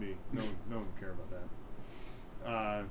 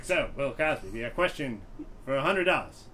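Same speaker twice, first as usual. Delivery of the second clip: Regis Philbin impression; 4 semitones higher than usual. As the quiz host reading the question. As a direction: read the choices with a game show lilt. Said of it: "And be like".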